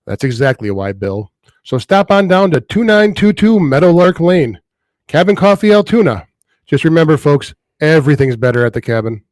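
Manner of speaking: folksy local radio ad voice; radio ad read. Acted. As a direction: sell the coffee shop like a radio spot. Scripted coffee ad read.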